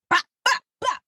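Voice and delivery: high, punchy mock kick sounds; imitated kick noises. Friend imitating the sister's kicks with bops. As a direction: pop out each bop like a strike.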